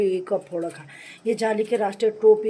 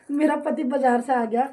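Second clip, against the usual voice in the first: ordinary delivery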